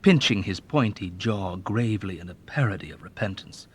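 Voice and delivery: ordinary delivery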